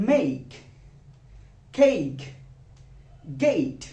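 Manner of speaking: make, cake, gate, each with a stretched "ey" glide; slow, separated, emphatic make, cake, gate; diphthong drill. Shown as a demonstration. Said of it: "it's actually diphthong".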